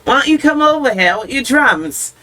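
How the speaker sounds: mock Cockney accent. Shown as a demonstration. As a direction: uncertain British accent, part Cockney. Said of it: "There's a little bit of Cockney".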